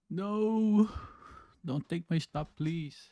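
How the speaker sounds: high, tense desperate no; scared pleading. Real, not acted. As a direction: beg the market, panicked. Pleading as price nears stop.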